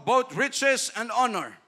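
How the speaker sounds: slow, loud and high; verse on riches and honor, slow and loud; scripture reading. Acted. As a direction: declaim it slowly. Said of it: "quotes scripture".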